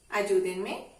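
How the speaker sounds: ordinary talk, nothing marked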